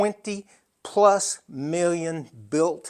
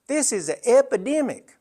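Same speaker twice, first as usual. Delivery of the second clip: higher, lightly voiced nurse stating epidemic; a hint of a firm Southern nurse, higher; Southern head nurse; 8 semitones higher, 4 dB louder than usual. Nurse telling a grieving son, lightly voiced higher. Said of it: "That's exactly the way she said it".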